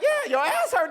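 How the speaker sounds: high shouted tease; teasing. Retold or imitated. Friends teasing the drunk friend, high laughing shout. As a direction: rub it in, laughing.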